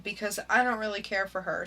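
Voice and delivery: ordinary delivery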